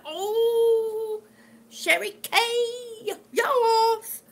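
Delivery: fighting back happy tears; choked up. Real, not acted. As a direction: voice breaking high with real tears. Moved to tears by the news.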